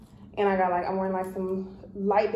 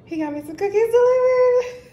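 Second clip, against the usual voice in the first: much higher and louder, gushing; excited; 14 semitones higher, 9 dB louder than usual. Real, not acted. Telling viewers about boyfriend's surprise cookies, overjoyed and gushing. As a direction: burst out the news, overjoyed.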